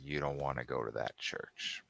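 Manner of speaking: a father warning the stranger in a hushed voice; low ominous warning. Acted. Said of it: "He narrows his eyes and says".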